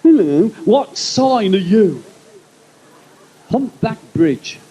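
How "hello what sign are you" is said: slow, drawn-out mock party bore; lightly voiced nosy guest asking star signs; imitating party bore. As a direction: partygoer quizzing him on his star sign, slow mock voice. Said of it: "someone's always coming up going".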